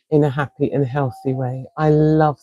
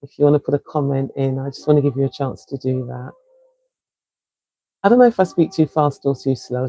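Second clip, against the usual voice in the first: usual speaking voice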